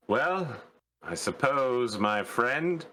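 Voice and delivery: lightly put-on London accent, drawn out; patronising London gent; fake English accent. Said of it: "full on faking an English accent".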